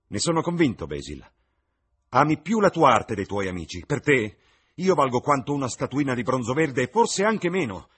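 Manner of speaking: high, loud bitter reproach; irritated. Acted. Dorian rimprovera Basil, irritato e acceso. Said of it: "Sembrava molto irritato".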